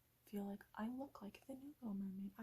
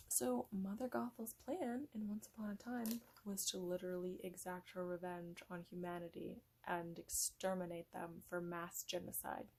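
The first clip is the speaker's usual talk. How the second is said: ordinary talk, nothing marked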